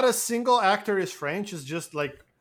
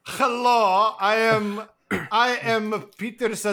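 a hammed-up German accent, higher; mock German accent; 5 semitones higher than usual. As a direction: introduce yourself like a cartoon German agent. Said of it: "was totally German".

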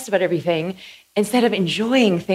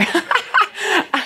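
cackle; 10 semitones higher than usual. Real, not acted. Speaker amused by her own joke, high cackle. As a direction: burst into a high, open cackle. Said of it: "there's that laugh again".

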